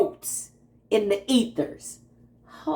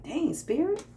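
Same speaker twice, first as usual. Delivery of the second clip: higher, playful disbelief at Spirit; teasing Spirit, amazed and higher; mock amazement; 11 semitones higher than usual. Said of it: "I'm like".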